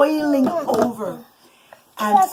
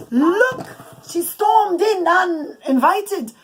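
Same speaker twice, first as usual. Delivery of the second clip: higher, louder, slow accusing tattle; indignant Haman; 6 semitones higher, 3 dB louder, much slower than usual. Acted. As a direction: point at her and tell on her. Haman voiced accusing Esther.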